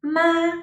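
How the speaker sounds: high, level "ma"; "ma" sustained on one high pitch; first tone. Shown as a demonstration. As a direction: hold "ma" high and steady. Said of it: "recap these four tones".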